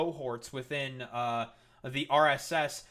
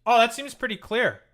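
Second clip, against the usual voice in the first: louder, higher pointed "Oh, that seems pretty clear"; sarcastic emphasis; 6 semitones higher, 7 dB louder than usual. Real, not acted. Streamer to chat after the candidate speaks, pointed and sarcastic. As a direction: land it as an obvious told-you-so.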